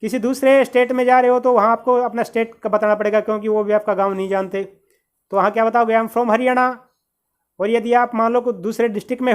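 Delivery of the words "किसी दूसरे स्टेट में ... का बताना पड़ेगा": normal talking voice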